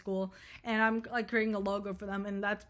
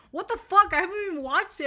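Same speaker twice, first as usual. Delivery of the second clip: loud disbelief; 8 semitones higher, 7 dB louder than usual. Real, not acted. Mocking herself for recommending Wonka.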